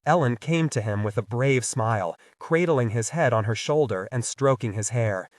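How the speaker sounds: ordinary delivery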